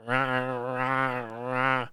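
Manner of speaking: soft, mocking imitation of "Rah, rah, rah" as wordless grunts; mock grunting "rah". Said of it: "believe somebody sitting there going".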